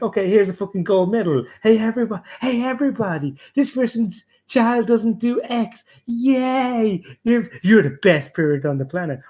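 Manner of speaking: high, hammed-up public announcement; mock-cheer as if handing out a medal; sarcastic celebration. Sarcastic acclaim for boasting parent.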